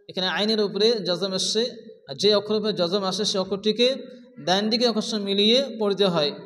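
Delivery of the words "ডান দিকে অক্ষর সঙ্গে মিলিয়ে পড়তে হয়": ordinary talk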